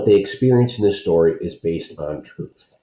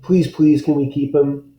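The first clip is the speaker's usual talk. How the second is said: put-on higher, pleading kid voice; lightly voiced begging boy; begging child; 7 semitones higher than usual. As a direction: beg for the puppy.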